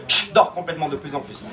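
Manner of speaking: low, hushed order to sleep; hypnotic sleep command. Acted. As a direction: ease her down into sleep. Hypnotist sending her to sleep.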